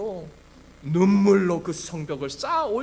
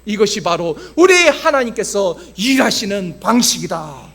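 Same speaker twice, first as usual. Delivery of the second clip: loud, emphatic sermon delivery; forceful preaching; 2 semitones higher, 8 dB louder than usual. Acted. Pastor to congregation on God defeating Goliath, forceful preaching. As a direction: proclaim it with full pulpit force.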